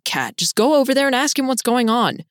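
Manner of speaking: pleading with mild exasperation; exasperated urging. Acted. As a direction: push a stubborn friend, exasperated. Exasperated friend urges her over.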